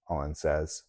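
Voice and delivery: ordinary delivery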